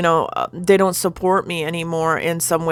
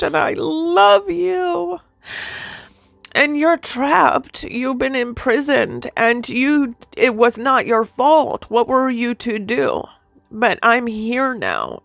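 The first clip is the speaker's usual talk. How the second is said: tender reassurance; 6 semitones higher, slower than usual. Retold or imitated. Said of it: "And he's like".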